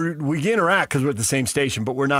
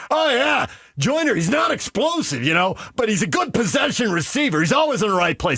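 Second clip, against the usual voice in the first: football bro; 9 semitones higher than usual. Retold or imitated. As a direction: talk football like an eager bro. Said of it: "And he's like".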